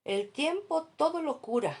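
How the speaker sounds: "El tiempo todo lo cura" mucho más agudo y fuerte; frase célebre burlona. Retold or imitated. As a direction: exagera el cliché para ridiculizarlo. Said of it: "Otra frase célebre". (cita burlona, aguda y fuerte)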